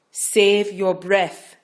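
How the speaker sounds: loud, very slow and pressing; urgent. Acted. Midwife cutting off Rachel, urgent and loud. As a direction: Save stressed hard and stretched. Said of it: "more urgently".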